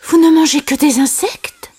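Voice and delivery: high-pitched questioning figure; high character voice. A narrator's reading. Simba questions his friends' diet, high character voice.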